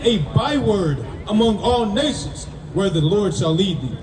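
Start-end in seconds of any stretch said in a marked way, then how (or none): none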